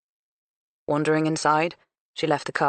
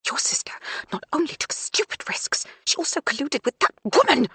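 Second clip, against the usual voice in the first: accusing mother; 11 semitones higher than usual. A narrator's reading. Mother accusing Nell to son.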